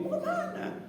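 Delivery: surprised question. Retold or imitated. Husband asks surprised.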